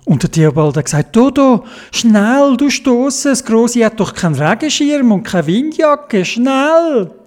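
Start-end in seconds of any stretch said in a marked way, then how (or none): 1.1-7.1 higher, hurried urging; urgent child. A narrator's reading. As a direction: push your friend to hurry. Theobald urges in the rain. (drängend, hohe Kinderstimme)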